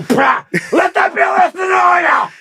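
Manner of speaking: high, fast hammed-up scream; tabletop warning yell. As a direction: shout it wildly from the tabletop. Acted dad atop the table.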